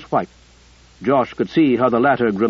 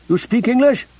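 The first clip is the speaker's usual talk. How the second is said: higher, called across to a stranger; 10 semitones higher than usual. A narrator's reading. Soldier calls to the woman at gunpoint, raised and wary. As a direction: call it out, guarded soldier. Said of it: "He called".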